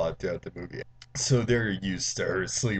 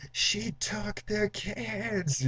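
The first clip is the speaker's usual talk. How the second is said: very high, slow mock wail; panicked kids; 12 semitones higher, much slower than usual. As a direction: imitate children crying out in alarm, stretched out. Kids phone in panic, acted.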